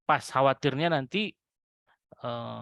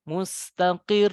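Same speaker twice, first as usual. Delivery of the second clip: mustaqir slow, rising on the doubled ra; raised ending; 3 semitones higher, 3 dB louder, slower than usual. Shown as a demonstration. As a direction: lift mustaqir at the end, slowly. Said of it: "Nah, dinaikkan".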